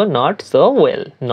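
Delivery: "not so well" drawn out word by word; slow first take of "not so well"; teacher saying "not so well" slowly; slow demo. Shown as a demonstration. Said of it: "বলার ক্ষেত্রে বলবো".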